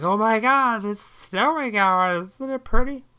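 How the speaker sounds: high, loud gushing mock; mocking people gushing about the first snow, high and loud; gushing over snow. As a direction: squeal it like a thrilled neighbour. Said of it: "everybody's like".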